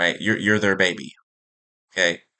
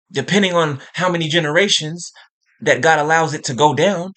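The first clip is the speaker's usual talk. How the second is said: raised, heated warning in grandma's voice; the medium voicing a mad grandmother, heated and raised; channelled angry grandma; 4 semitones higher than usual. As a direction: let grandma's anger push the words up. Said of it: "you hear the tone in my voice".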